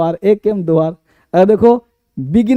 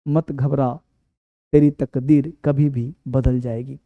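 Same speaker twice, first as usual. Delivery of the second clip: poem recital; 5 semitones lower than usual. Acted.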